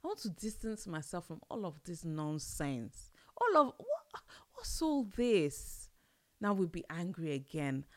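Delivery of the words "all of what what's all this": mock disbelief. Mock exasperated outburst.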